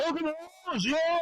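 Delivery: high, gleeful taunting cheer; mock cheer. Worker taunting the Aggie fan boss, high gleeful cheer. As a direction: rub the team win in his face.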